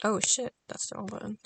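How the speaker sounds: usual speaking voice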